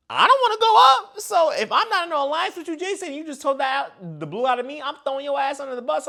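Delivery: high heated shouting; loud, indignant call-out; angry rant. Retold or imitated. Houseguest threatening over alliance.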